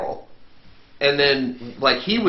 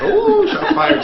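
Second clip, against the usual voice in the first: teasing; 5 dB louder, much slower than usual. Real, not acted. Teasing after a friendly jab.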